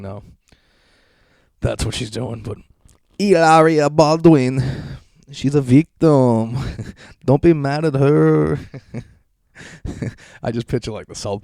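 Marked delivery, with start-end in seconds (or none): 3.2-8.6 slow, higher, louder sarcastic voice; slow mock-sympathetic drawl; sarcastic mock pity. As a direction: fake sympathy laid on thick. Sarcastic defending voice.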